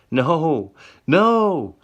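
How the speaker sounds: high, loud anguished cries; rising frantic wail; desperate cries. Acted. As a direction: cry out in panic. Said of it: "she cries".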